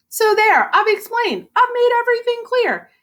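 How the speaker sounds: high, triumphant bluff; smug insistence. Acted. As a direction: close the case proudly. Murray insisting after bluffing.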